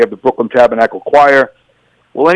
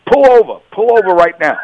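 higher, insistent retold command; urgent order; 7 semitones higher than usual. Officer to his partner in the radio car, urgent. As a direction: tell him to pull over, urgently.

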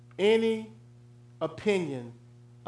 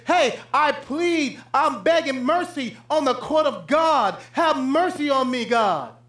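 pleading sinner; 6 semitones higher, 3 dB louder than usual. Retold or imitated. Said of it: "You would think that he said".

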